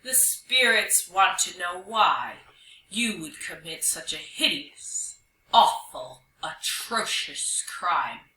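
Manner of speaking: mock-solemn spirit, weighty pauses; slow, deep voice. Young hyena in character demanding Dog's reason, slow deep voice. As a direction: intone it slowly like a judging ghost. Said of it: "in a slow, deep voice".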